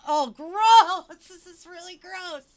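high, loud cry of revulsion; disgusted shout. Real, not acted. Reading a gross passage, she shrieks in disgust. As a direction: recoil from the text out loud.

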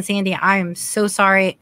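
ordinary delivery

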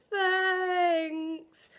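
one long, high, loud drawn-out 'thanks'; hammed-up awkward gratitude, stretched out; awkward thanks. Retelling thanking a manager without hugging her, awkward thanks. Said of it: "that made it even more awkward".

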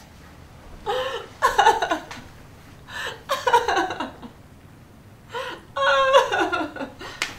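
high, breathless laughing fit; relieved laughter. Real, not acted. Player over the tree at last, high hysterical laugh. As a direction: laugh helplessly, close to crying.

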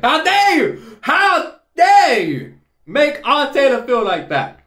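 indignant and hammed-up, stretching the words; mock outrage. Mock outrage, has said he's joking.

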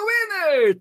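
high, loud shouted winner. Real, not acted. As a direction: belt out winner. Winner said twice, quiet then shouted.

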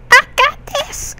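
a hint of George Lopez cheering himself on; George Lopez impression. Gamer quoting George Lopez, hinting at his voice. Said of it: "As George Lopez would say".

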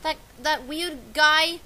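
ordinary talk, nothing marked

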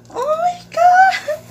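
very high, loud, drawn-out oh my God; shrieking surprise; shocked shout. Real, not acted. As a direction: scream it in disbelief at the gift. Opening gift, overwhelmed.

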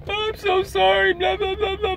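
high, slow mocking whimper of a fake tearful apology; mock crying apology. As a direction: mimic her sobbing sorry in a squeaky falsetto. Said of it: "she starts breaking down, crying".

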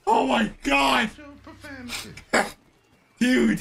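ordinary delivery

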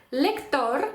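Spanish «Лектор», lek-TOR; final-syllable stress. Shown as a demonstration. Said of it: "останній склад".

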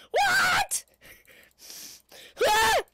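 very high loud scream; shocked scream. Real, not acted. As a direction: scream in sudden fright. Sudden scream in game.

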